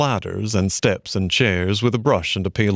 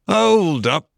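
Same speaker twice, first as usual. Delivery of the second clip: high, loud rabbit calling halt; old rabbit stopping him; elderly rabbit; 9 semitones higher, 4 dB louder than usual. A narrator's reading. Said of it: "said an elderly rabbit".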